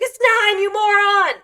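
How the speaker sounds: high, loud hammed-up shout; angry dad yelling. Dad berating child over times tables, mock-furious yell. Dad yells insult, retold.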